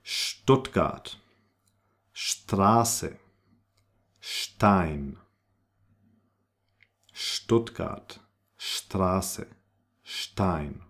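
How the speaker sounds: Stuttgart, Straße, Stein begun with sht; spaced model words, Straße as "shtrasse". Shown as a demonstration. Teacher drills German ST twice over, sh before the t. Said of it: "like if there was a little H".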